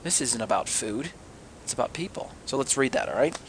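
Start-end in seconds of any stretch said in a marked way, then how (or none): 0.0-2.3 hushed, dawning realisation; quiet confession. Acted. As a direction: drop right down as the meaning sinks in. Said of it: "Peter then confesses".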